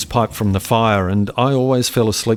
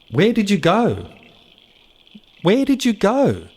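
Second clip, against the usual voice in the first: calling out; 10 semitones higher than usual. Acted. Boy searching for his brother.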